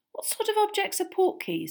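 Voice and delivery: high, light questioning; curious. A narrator's reading. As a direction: ask with real curiosity. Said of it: "said Harry curiously".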